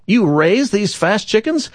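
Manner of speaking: slow, high-pitched disbelief; incredulous driver. Acted. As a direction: stretch the question out in amazement. Driver questions the farmer in amazement.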